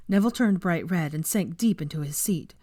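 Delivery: plain reading voice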